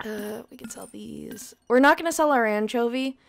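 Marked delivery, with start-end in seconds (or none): 0.1-1.5 soft whisper. Real, not acted. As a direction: whisper it quietly. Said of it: "I might be a little quiet".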